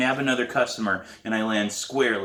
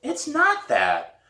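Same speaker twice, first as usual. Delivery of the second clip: put-on protesting girl; slower than usual.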